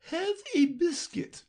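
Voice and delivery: very high brisk queen; matter-of-fact and a little impatient. A narrator's reading. Queen answers Alice, very high and brisk.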